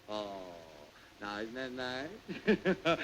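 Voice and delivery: low, very slow cooing; mock sweet. General coos over the blindfold, low and slow. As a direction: coo it, hammed-up sweet.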